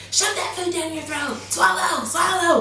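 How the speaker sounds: high mock urging, swallow swallow; hammed-up high voice hurrying a baby to eat; pushy feeder. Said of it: "this sounds bad".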